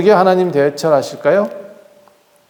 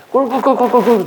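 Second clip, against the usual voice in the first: oinking pigs; 6 semitones higher than usual. Retold or imitated. Pigs squealing together at feeding time.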